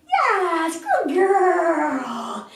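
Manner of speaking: excited high dog-praise voice; puppy praise. Real, not acted.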